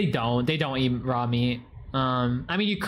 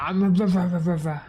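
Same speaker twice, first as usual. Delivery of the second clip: higher, louder babbling animal voice; louder, higher gibberish for the animal; mock animal voice; 4 semitones higher, 4 dB louder than usual. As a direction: voice the pet's nonsense chatter. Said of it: "He's like".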